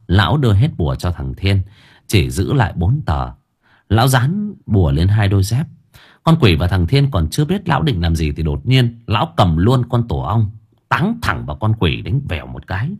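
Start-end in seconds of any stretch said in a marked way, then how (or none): none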